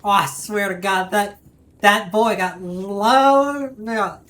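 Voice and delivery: loud excited Southern hollering; excited Southern accent. Pretend. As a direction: whoop it up like a country boy. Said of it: "do the Southern accent".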